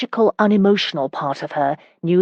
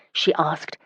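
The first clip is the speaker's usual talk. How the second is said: ordinary delivery, nothing marked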